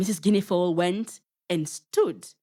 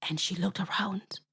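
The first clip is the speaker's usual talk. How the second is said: whisper; 6 dB quieter than usual. A narrator's reading. Sneaking carefully past the potatoes.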